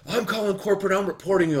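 high, loud imitation of an irate customer; mock outraged customer. Angry lady threatens random customer, mock outraged.

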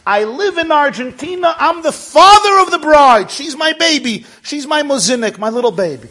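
high, loud, gushing declaration; proud exuberant father. Retold or imitated. Father of the bride telling the rabbi, loud and proud. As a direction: announce it at the top of your voice.

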